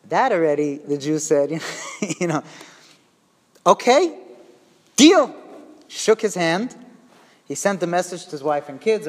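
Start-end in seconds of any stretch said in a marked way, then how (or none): 3.7-5.3 high, loud, punchy agreement; retold eager "deal"; emphatic acceptance.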